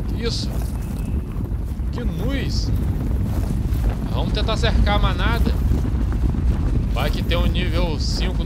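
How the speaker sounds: loud, thrilled shouting at the herd; excited. Real, not acted. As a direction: yell with real hunting excitement. Stampede of buffalo, hunting excitement.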